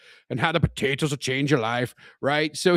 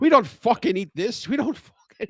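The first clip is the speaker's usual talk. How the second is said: Irish guy ranting; 8 semitones higher, 5 dB louder than usual. Retold or imitated. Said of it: "he's like".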